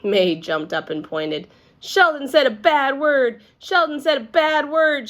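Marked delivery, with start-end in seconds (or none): 1.8-5.1 high, loud singsong tattle; loud tattling. A narrator's reading. May tattles on Sheldon to the teacher, loud and high. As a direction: tell on him to the whole room. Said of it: "she tattled real loud".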